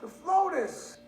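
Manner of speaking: high, loud incredulous cry; can't believe they don't care; outraged disbelief. Retold or imitated. Acted outrage.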